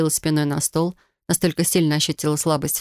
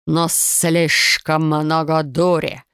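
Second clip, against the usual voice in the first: slow, heavy serpent drawl; much slower than usual. Acted. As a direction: press it slowly like a snake lord. Snake man rebukes heroine.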